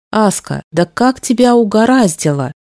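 usual speaking voice

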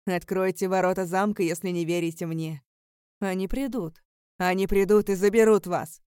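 doom proclaimed at full voice; loud menacing warning. A narrator's reading. Chained Alaric warning the prince of doom, loud and menacing. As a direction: build to a thundering threat.

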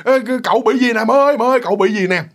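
high, loud panicked calling out; frantic yelling. Retold or imitated. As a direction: scream for grandma in panic. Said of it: "bà la liền".

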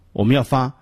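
ordinary talk

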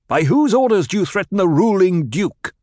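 high, loud accusing question; stern demand. A narrator's reading. Paul confronting the Sardaukar, stern loud demand. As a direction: demand an answer from the intruders.

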